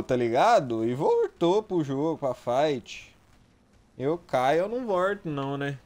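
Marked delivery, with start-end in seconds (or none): none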